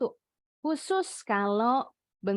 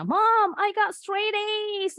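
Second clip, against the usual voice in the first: put-on high, excited voice of a child showing off; proud kid bragging; 12 semitones higher, 4 dB louder, slower than usual. A child proudly tells mom about perfect grades. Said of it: "langsung dibanggain di orang tuanya".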